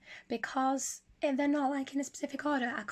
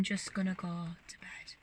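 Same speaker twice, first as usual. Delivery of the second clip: whispering; 5 semitones lower, 7 dB quieter than usual. Real, not acted. Late night, whispering.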